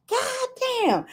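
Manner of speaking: very high-pitched stunned reaction; mock-shocked high exclamation; retold astonishment. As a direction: squeal the disbelief up high. Acts out her stunned reaction.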